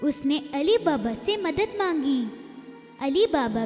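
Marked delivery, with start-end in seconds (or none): none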